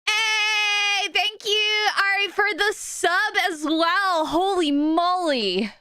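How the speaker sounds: high, drawn-out squeal of thanks; excited thanks. Real, not acted. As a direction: gush it high, stretching the last words. Thanks viewer for surprise gifted sub.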